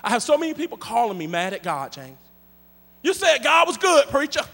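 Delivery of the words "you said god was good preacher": higher, louder complaint; mock indignant caller. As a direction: whine like a mad caller. Angry callers, preacher answers.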